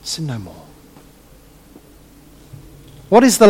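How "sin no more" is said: sin no more, low and much softer; very quiet. Retold or imitated. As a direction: drop it to almost nothing. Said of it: "the little word of".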